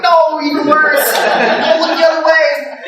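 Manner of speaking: high, slow, dismayed pleading; hammed-up high-pitched dismay; comic distress. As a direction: wail at the lamb in mock horror. Pleads as lamb licks gun.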